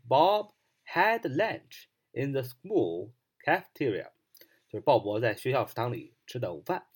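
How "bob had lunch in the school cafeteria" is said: slow, careful English model sentence. Shown as a demonstration. Teacher models the sentence slowly for students. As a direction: lay out each word slowly. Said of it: "你要说".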